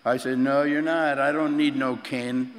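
ordinary delivery

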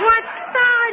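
high, loud mock yell; hammed-up shocked mom yell; mom screaming. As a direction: shriek it like a shocked mother. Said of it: "she's screaming at me".

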